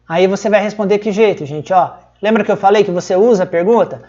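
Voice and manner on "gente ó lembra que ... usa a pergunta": ordinary talk, nothing marked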